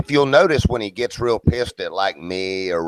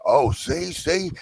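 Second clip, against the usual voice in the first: higher taunting sing-song, ending in a laugh; mocking Ronan; 5 semitones higher than usual. Ronan taunting someone who defends themselves, smug mock voice. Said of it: "try to defend anything that he said".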